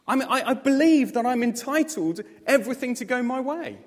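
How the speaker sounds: ordinary talk, nothing marked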